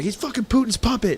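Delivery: high, loud mock accusation; imitated screaming. Brother ranting back about Trump, imitated scream. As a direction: yell the charge as a ranting brother. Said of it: "screaming the top of his lungs".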